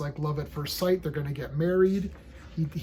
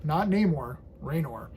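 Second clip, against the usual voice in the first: normal talking voice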